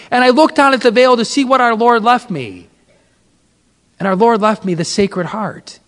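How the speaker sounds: ordinary delivery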